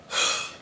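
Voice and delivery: sharp, audible intake of breath; mock gasp. Patient exposed as faking seizure, offended gasp. Faker reacts to being exposed.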